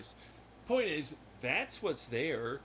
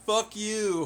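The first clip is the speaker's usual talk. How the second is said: louder, higher fall on fuck; bitten-off, firm "Fuck you"; angry retort; 6 semitones higher, 7 dB louder than usual. Retold or imitated. Pissed-off reply to the other faction, firm angry retort. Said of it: "I was just so pissed".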